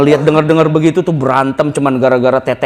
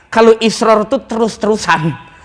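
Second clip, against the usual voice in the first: ordinary talk, nothing marked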